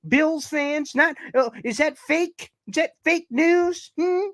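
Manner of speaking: mocking taunt. Real, not acted. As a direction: tease them in a sing-song voice. Taunting Bills fans.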